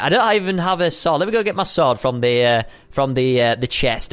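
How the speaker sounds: ordinary talk, nothing marked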